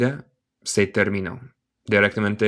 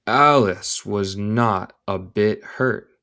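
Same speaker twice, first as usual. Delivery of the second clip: slow careful model; slower than usual. Shown as a demonstration. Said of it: "Note mi pronunciación".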